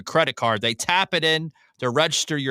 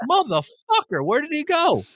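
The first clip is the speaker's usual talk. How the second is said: high, strained exasperated cry; hammed-up panic at being stranded; 11 semitones higher than usual. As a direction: voice climbing high in disbelief. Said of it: "So I'm like".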